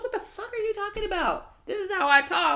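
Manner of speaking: high, incredulous mock outrage; indignant retort. Jada snapping back at Will over cursing, high mock outrage. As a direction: throw it back at him, offended.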